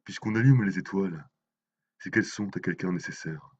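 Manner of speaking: solemn, measured poem reading; dramatic poem, phrase by phrase; poetry recitation. Acted. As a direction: recite the verse with weight, pausing between phrases. Recites translated poems by Mayakovsky.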